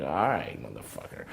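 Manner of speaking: low muttered curse; muttered under breath. Retold or imitated. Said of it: "I just looked at him like".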